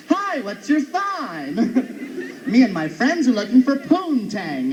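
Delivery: slow, drawn-out camp pickup line. Acted. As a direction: flirt in a put-on flamboyant lilt.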